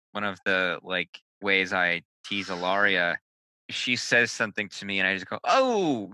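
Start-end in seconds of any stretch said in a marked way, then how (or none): 5.4-6.1 put-on wounded gasp in Italian accent; mock wounded Italian. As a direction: act wounded, Italian style. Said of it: "like I've been wounded".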